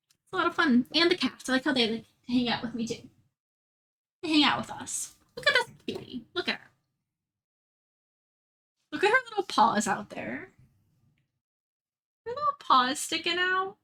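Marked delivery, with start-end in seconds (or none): 5.4-13.8 high cooing over a cute cat; overwhelmed by cuteness. Real, not acted. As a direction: gush over the kitty, pitched up.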